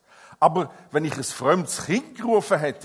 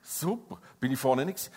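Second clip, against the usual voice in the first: lightly voiced offhand waking thought; mock casual; 4 semitones lower than usual. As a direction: toss it off casually. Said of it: "Niemand ist heute Morgen aufgewacht".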